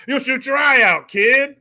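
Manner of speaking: loud, high hammed-up warning; shouted movie-line impression. Warning a kid about his eye, hammed-up shout.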